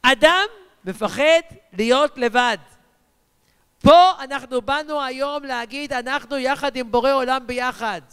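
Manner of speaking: emphatic declaration held on an even pitch; forceful rallying preacher; preaching. Acted. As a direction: proclaim it firmly to the whole congregation. Preaches on not being afraid.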